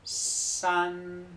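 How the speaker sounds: "San" con s clara y tono tercero. Shown as a demonstration. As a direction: marca la s de "San". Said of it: "¿Os fijáis en la S?". ("San" en tercer tono)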